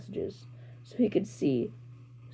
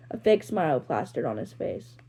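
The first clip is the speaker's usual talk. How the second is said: plain reading voice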